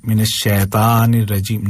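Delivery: "शैतानिर" with flat ae and no glide; wrong shaitan vowel. Shown as a demonstration. Teacher showing what not to read, "शैतानिर" without glide. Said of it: "नहीं".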